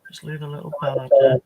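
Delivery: loud, speaking over machine noise; shouting as noise stops. Real, not acted. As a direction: raise your voice over the noise, then it stops. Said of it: "Oh, that was loud".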